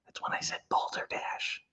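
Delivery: put-on hushed whisper so the kids won't hear. Streamer hiding a mock swear from viewers' kids, whispered. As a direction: mouth it in a breathy secret whisper. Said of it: "if I don't want the kids to hear".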